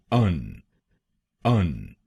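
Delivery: अन said "un", reduced vowel; last syllable. Shown as a demonstration. Teacher isolating the last syllable for learners, reduced. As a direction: keep अन a weak "un". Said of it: "आईओएन हम बोलेंगे".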